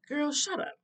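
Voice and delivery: dismissive mother. Retold or imitated. Mother brushing off her daughter's protest, dismissive. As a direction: imitate a mother shutting down her child.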